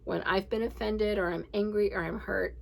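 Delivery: ordinary talk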